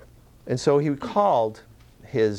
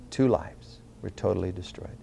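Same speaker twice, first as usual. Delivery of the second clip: softer, lower, grave echo; hushed solemn repeat; 5 semitones lower, 6 dB quieter than usual. Real, not acted. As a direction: let the repeated phrase sink down quietly. Repeats the phrase for solemn effect.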